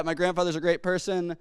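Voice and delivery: usual speaking voice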